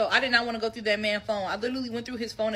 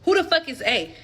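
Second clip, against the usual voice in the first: sharp, raised demand with no patience; stern demand; 4 semitones higher, 3 dB louder than usual. Retold or imitated. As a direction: confront him straight, all business. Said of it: "I'm on straight business".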